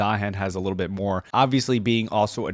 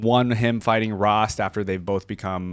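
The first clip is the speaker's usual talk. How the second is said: ordinary talk, nothing marked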